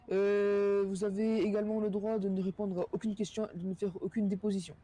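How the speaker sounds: ordinary talk, nothing marked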